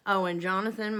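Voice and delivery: ordinary talk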